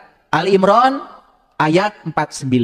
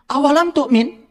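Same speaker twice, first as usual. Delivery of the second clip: higher, teasing mock-Arabic question; 8 semitones higher, slower than usual. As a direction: tease a doubting husband in Arabic. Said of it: "kalau suami, ya, nggak percaya".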